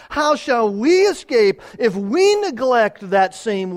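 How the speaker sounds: high and loud, pressing the point home; preaching at full force. Acted. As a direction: proclaim it to the whole church.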